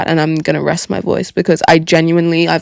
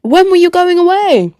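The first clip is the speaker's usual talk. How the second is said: high, louder "what?" in a surprised viewer voice; mock surprise; 12 semitones higher, 5 dB louder than usual. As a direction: play a startled fan, pitch jumping way up. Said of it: "you guys are gonna be like".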